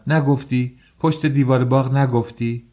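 wife angrily confronting her husband; angry. A narrator's reading. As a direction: snap back in anger. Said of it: "با خشم گفتم".

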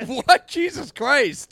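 loud, high, stretched-out shock; real disbelief. Real, not acted. Co-host reacting to bathroom frequency, loud incredulous outburst. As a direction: blurt it out, pitch shooting up. Shocked at three times a day.

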